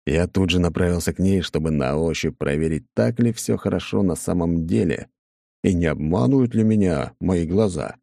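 ordinary delivery, nothing marked

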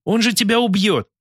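high frightened plea; alarmed. Acted. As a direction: fear for him, pitched high. Anka alarmed at Artur.

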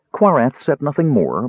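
usual speaking voice

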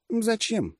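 high-pitched surprised question; удивление. A narrator's reading. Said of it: "удивилась гадюка". (удивлённо, высоким голосом)

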